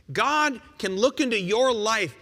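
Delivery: ordinary talk, nothing marked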